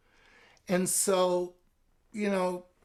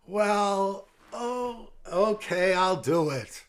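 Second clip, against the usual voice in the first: caving in; 5 semitones higher, 4 dB louder, slower than usual. Shown as a demonstration. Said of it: "there goes my Friday night".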